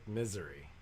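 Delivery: "misery", first syllable stressed, ending in -ree; Missouri said as misery. Shown as a demonstration. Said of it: "they pronounce it".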